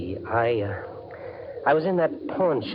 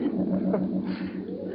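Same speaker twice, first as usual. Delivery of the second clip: gleeful chuckle; 8 semitones higher, 4 dB quieter than usual. Acted. Gloating alone in vault.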